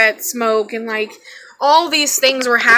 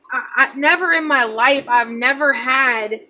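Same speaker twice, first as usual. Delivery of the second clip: plain reading voice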